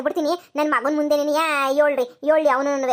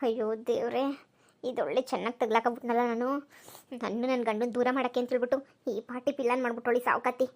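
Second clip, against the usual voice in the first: panicked aside; 2 semitones lower, 9 dB quieter than usual. Acted. Mother-in-law realises she is caught, a much softer despairing aside. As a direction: groan it under your breath, trapped.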